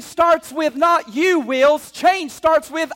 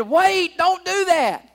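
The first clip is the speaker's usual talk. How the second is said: urgent plea; 5 semitones higher, 4 dB louder, slower than usual. Retold or imitated. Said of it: "Paul said".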